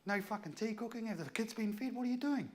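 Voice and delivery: high, heated interrogation; retold yelling. Role-playing husband berates partner over undone chores, yelling. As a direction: re-enact a tirade, pitch right up. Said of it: "over the top of her yelling and screaming".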